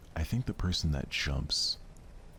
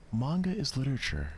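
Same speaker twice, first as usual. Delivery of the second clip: higher, sharp indignant protest; indignant Natsuki; 10 semitones higher, faster than usual. Acted. Natsuki retorting to Yuri, indignant and high. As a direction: snap back defending manga.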